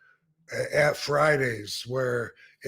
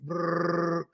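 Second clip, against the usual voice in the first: phone ring; 4 semitones higher than usual. Retold or imitated. Phone rings in story.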